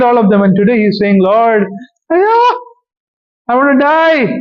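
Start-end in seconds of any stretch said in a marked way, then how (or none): 1.2-4.3 high, slow, whiny wail of a man who wants to die; whining despair. Acted. Elijah whining to God that he wants to die. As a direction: moan it like a defeated prophet begging to quit.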